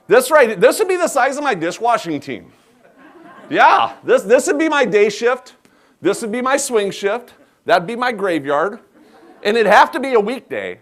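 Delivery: high, loud and laughing as he boasts; bursting with laughter at the sheer size; laughing exaggeration. Real, not acted. Chef laughs to audience about his huge dishwashing team, high and loud.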